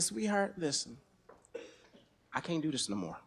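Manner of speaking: soft, low and tired to his wife; soft resignation. Retold or imitated. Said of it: "I said".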